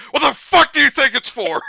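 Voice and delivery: high, loud mock outrage; mock angry snap. Friends riffing, Harvey snapping at his questioner. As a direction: bark it back at the cane question.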